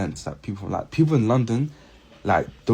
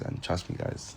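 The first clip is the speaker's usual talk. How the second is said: whisper; 2 semitones lower, 10 dB quieter, slower than usual. Real, not acted. Confiding aside to viewers.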